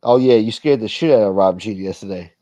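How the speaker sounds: ordinary talk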